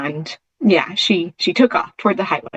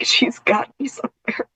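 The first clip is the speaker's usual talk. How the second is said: whispering; 5 semitones higher, 4 dB quieter than usual. Retold or imitated. Imagined thought of lost dog.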